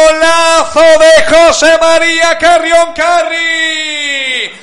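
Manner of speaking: long drawn-out goal scream; goal shout. Real, not acted. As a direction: stretch it out, full-throated.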